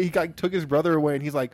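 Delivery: ordinary talk, nothing marked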